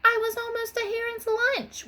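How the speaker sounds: high indignant sputter; high, rattled disbelief. A narrator's reading. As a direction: splutter out the near miss. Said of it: "Wanda sputtered".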